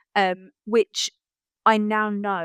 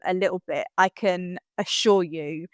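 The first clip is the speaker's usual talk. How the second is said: usual speaking voice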